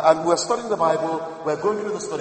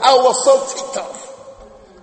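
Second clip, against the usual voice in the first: slow, stretched, higher mock annoyance; ticked off; 7 semitones higher, 4 dB louder, much slower than usual. Preacher tells congregation how ticked off he was. Preacher recalls anger at hack.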